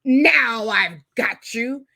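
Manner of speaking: a villain savouring his trap; crowing villain. Acted. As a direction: gloat over the trapped snowman. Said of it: "the magician crowed".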